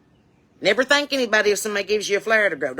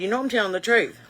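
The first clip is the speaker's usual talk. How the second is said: ordinary talk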